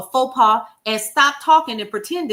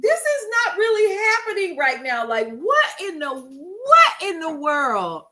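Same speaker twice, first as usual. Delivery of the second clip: stunned disbelief; 7 semitones higher than usual. Retold or imitated. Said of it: "I was like".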